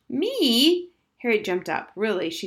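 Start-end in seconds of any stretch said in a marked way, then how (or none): 0.1-0.9 high, loud incredulous squawk; shocked. Acted. Girl asked if she's considering marriage, shocked. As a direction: recoil in disbelief at the idea.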